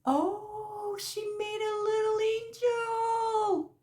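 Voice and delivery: high tender awe; delighted coo. Real, not acted. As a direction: coo over the gift. Opens gift, delighted.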